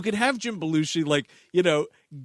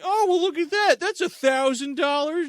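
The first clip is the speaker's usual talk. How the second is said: much higher, mock-appraising voice; 10 semitones higher than usual. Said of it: "he's just like".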